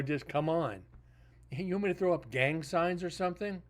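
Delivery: usual speaking voice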